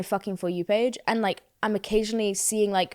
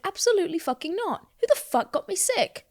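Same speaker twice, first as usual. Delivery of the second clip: higher, mock-furious disbelief; indignant outburst; 10 semitones higher than usual. Retelling her night to listeners, mock-angry outburst. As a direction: squeal it like you can't believe it. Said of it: "And I'm still angry about it".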